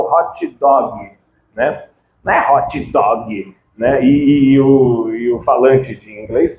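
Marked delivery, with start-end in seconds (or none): 0.0-1.1 "hot dog" as Brazilian "hotchi dogui"; Brazilian-accented English. Shown as a demonstration. Teacher imitating Brazilians ordering food, "hot dog" in Brazilian accent. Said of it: "precisa colocar uma vogal no final". 2.5-3.6 "hot dog" as Brazilian "hotchi dogui", sounded out; Brazilian-accented English. Shown as a demonstration. Teacher repeating the Brazilian habit, "hot dog" with added vowels. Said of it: "precisa colocar uma vogal no final".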